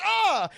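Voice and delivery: high acted yelp; imitated sharp high cry; mock yelp of pain. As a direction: yelp as if scratched. Acted scratched man crying out.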